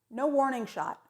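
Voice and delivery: normal talking voice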